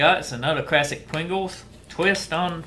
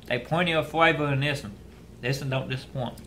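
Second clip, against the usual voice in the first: speaking between crunchy bites; talking while chewing. Real, not acted. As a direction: mouth partly full of chips. Seen 1: Bites a chip at -3, holds and nibbles chips throughout.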